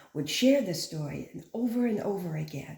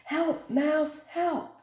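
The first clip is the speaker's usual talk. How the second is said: high pleading call; calling for help; 9 semitones higher, much slower than usual. Acted. Cat calling the mouse for help, high pleading call. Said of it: "the cat called the mouse".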